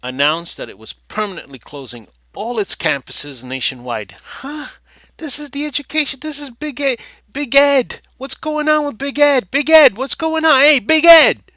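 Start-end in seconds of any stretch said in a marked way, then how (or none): 4.4-11.4 much higher squeaky mock voice, louder toward the end. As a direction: squeal it out like a cartoon character teasing. Mocking the education system as "Big Ed.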